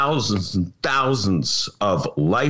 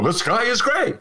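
parroting aldermen; 11 semitones higher than usual. Retold or imitated. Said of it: "the aldermen go".

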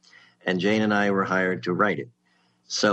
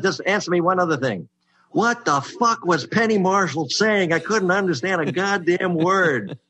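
exasperated outburst; 10 semitones higher, 3 dB louder than usual. Retold or imitated. Olympia outside the pitch, asking in high blunt disbelief.

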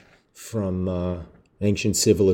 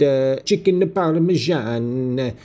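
mock Italian-American accent while listing dishes; 5 semitones higher than usual. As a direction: lay on a corny Italian-American accent for the food. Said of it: "I know I'm just saying American, Italian-American dishes".

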